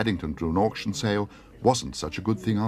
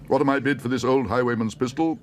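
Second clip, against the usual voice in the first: higher, projected sale-room call; auctioneer's call; 6 semitones higher, 4 dB louder than usual. Acted. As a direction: offer the pistol to the room. Auctioneer offering a lot.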